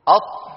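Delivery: أط with the tongue held shut on the ط, no release; held stopped taa. Shown as a demonstration. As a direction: press the tongue and trap the air on أط. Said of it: "الطاء كذلك، لا تفلتوها". (أط بحبس الهواء دون إفلات الطاء)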